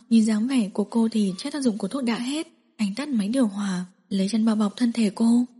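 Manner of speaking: usual speaking voice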